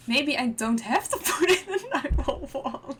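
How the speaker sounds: loud, laughing through the words. Real, not acted. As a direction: laugh as you say it. Laughs while talking.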